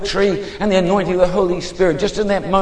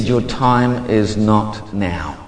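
slow, measured divine pronouncement; voice of God; 5 semitones lower, slower than usual. Retold or imitated. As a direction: speak as God, unhurried, with long pauses. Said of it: "He said".

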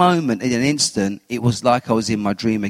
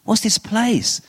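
rising higher in amazement, lightly acted; awed wonder; 5 semitones higher, slower than usual. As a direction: gasp of wonder at a strange place. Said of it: "And I looked and I thought".

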